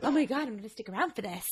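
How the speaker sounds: soft, laughing undertone; imitated hushed delight. Co-host voicing a viewer spotting the actor, hushed delight. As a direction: act an awed aside in the cinema.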